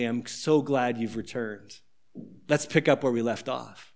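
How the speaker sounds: father gently embracing his son; tender welcome. Acted. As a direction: open your arms in quiet relief. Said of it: "the father opens his arms to embrace him and say".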